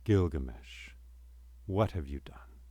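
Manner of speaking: soft, low and quiet; hushed grieving question; simple quiet question. Acted. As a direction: say it gently, almost to himself. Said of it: "and he said simply".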